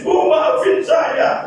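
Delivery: high, slow, whispered sobbing; crying plea. Acted. Son weeping at his mother's grave, whispered sobbing plea. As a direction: beg forgiveness through tears, slowly. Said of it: "Datang ke kuburannya nangis".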